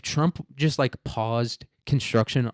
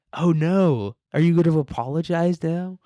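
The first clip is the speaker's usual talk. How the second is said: mock concern; 7 semitones higher, 5 dB louder than usual. Mocking comedians after Riyadh festival.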